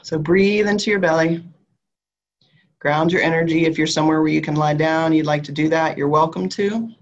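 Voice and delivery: calm grounding visualization, unhurried; guided meditation. Acted. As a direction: guide them gently down into the body.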